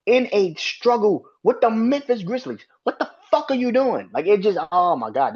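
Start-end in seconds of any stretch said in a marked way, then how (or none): 0.0-4.1 high-pitched exasperated ranting at a player; ranting in real disbelief, voice pitched well up; real angry rant. Real, not acted. Fan venting at Draymond over the foul-out, real angry high rant. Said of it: "that shit pissed me off".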